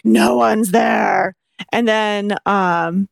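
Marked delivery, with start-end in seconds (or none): 0.0-1.3 slow, menacing growl. Retold or imitated. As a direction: snarl it out long at barking dogs. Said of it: "I was growling at them like".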